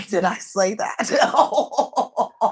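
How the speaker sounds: helpless laugh around the words. Real, not acted. After claiming to be the way to the Father, laughing. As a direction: crack up at your own outrageous line.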